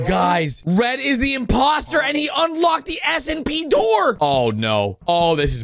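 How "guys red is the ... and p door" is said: raised, urgent call to the guys; excited shout. Real, not acted. Telling viewers Red is the imposter, in an excited shout. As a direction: shout the news out.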